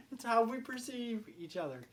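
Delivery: high-pitched, laughing explanation. Real, not acted. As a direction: talk on through the laugh. Amused by the film's stereotypes.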